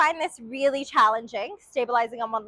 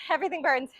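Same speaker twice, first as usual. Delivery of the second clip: ordinary talk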